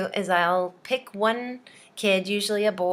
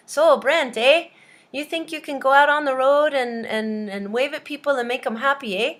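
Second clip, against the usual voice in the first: higher, louder hammed-up Canadian boss; 6 semitones higher, 5 dB louder than usual. Canadian guy interviewing Brent, hammed-up Canadian boss. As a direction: play a Canadian employer, punching each "eh". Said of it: "the Canadian guy said to him".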